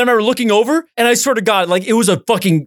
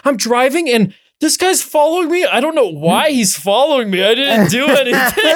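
panicked caller; 7 semitones higher than usual. Retold or imitated. Panicked 911 call retold.

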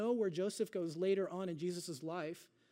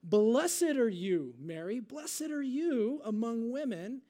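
slow, raised-voice blessing, opening loudest; loud exclamation; 4 semitones higher, 5 dB louder, slower than usual. A narrator's reading. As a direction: proclaim it loud and drawn out. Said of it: "in a loud voice, Elizabeth exclaimed".

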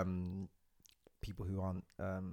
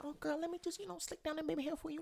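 very high, sweet put-on voice; imitating Lauren. Imagining Lauren offering to fix the wig, high and sweet. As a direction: offer help in a high girly voice. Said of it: "She was like".

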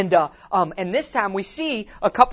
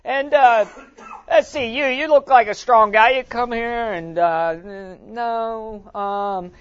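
mock careless team picker; 4 semitones higher, 8 dB louder, slower than usual. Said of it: "him going".

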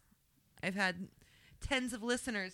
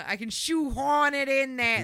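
put-on Boston accent, louder; 5 semitones higher, 6 dB louder than usual. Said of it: "whip out my Boston accent".

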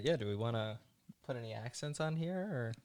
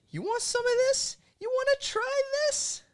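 high taunting voice; 7 dB louder than usual. Acted. Rusty taunting the old lady.

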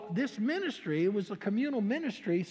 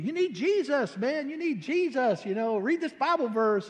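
street preaching; 6 semitones higher, 4 dB louder than usual. Retold or imitated. Street witnessing, calling out.

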